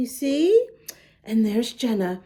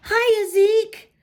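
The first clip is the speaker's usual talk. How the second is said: high, louder cheerful penguin greeting; penguin shout; 9 semitones higher, 4 dB louder than usual. Acted. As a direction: call out brightly as Parker, much higher. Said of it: "shouted Parker".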